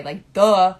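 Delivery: loud, scornful "duh"; sarcastic duh. Real, not acted. Mocking obvious point.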